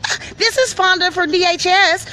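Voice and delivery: high, chirpy phone-answering voice; mock official greeting. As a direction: answer like a busy office worker.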